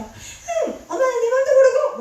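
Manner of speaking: put-on high, loud pouting child's huff; hammed-up whiny child yelling at mom; sulky child's outburst. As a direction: huff and shout like a sulking kid. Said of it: "막 이렇게 했던 아이가".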